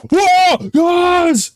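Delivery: very high, loud whoop of joy; shouted high delight; excited cheer. Real, not acted. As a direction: cheer the rescue. Cheering cat's arrival in game.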